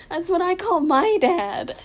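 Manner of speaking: high, loud, slow line of stunned recognition; mock shocked realization. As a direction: replay the moment it hit you. Quoting own shocked reaction.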